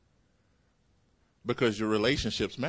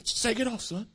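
encouraging coach; 12 semitones higher than usual. Retold or imitated. Holy Spirit as coach urging believers, high coaxing call. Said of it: "our coach, says".

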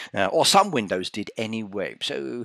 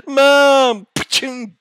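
much higher, louder whine; protesting child; 12 dB louder, much slower than usual. Acted. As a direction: cry out mum like a hurt kid.

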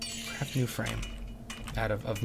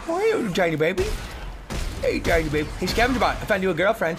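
higher, louder cooing at a little robot; baby talk to bot; 6 semitones higher, 5 dB louder, faster than usual. Pretend. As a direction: coo at it like a baby.

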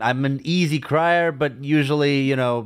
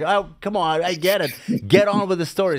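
impatient viewer; 6 semitones higher than usual. Retold or imitated. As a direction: hurry the film along. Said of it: "It's like".

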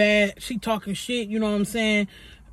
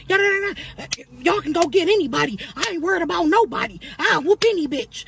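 put-on trash-talking girl; 6 semitones higher, faster than usual. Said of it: "girl talking hella shit".

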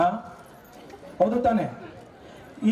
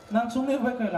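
normal talking voice

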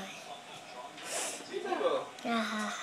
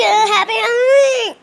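gleeful shout; 6 semitones higher, 6 dB louder than usual. Acted. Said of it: "drop out and say".

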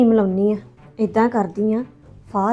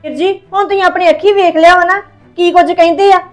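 higher and louder, wounded accusation; higher, louder aggrieved outcry; 5 semitones higher, 4 dB louder than usual. Acted. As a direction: demand why they stopped her. Staged suicide, complaining to brother.

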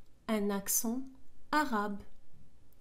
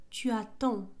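ordinary delivery

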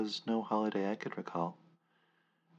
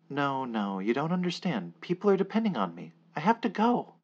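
climbing higher and louder, fast; panicked plea; 6 semitones higher, 8 dB louder than usual. Acted. Narrator desperate to escape the surrounding men, panicked. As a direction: panic rising with every word.

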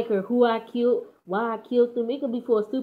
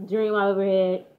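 normal talking voice

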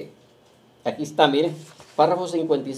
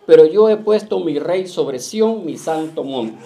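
slow, louder proclaiming of the verse; scripture reading; 7 dB louder, slower than usual. Acted. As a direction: lift the words up to the congregation. Preacher reads scripture aloud. (lectura solemne y lenta de la Escritura)

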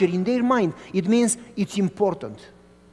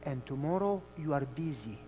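soft sermon; 4 semitones lower, 11 dB quieter than usual. Acted. Soft preaching over music.

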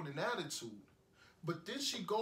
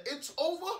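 high, loud quote with a rising end; with attitude; 11 semitones higher, 6 dB louder than usual. Retold or imitated. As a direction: throw her words back with attitude. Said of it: "Saying it with an attitude".

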